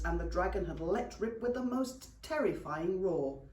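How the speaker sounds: plain reading voice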